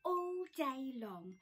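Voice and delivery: plain reading voice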